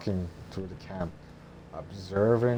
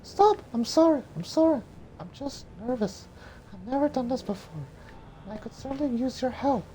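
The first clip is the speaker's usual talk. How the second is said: put-on high, fast nervous girl; high young woman voice; faster than usual. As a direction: blurt it high and flustered. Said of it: "Mulan worried grabs Mushu".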